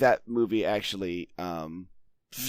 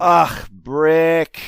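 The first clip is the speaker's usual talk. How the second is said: loud, higher mock exasperation; exasperated groan; 6 semitones higher, 10 dB louder than usual. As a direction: imitate friends groaning at a minor mishap. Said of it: "their responses are as, as if he spilled a drink".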